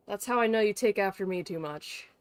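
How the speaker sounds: ordinary talk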